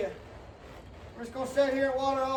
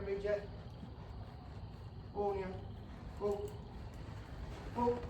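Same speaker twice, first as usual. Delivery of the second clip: soothing the colt; 5 semitones lower, 10 dB quieter than usual. Real, not acted. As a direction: settle the colt gently and quietly. Soothing a colt under saddle.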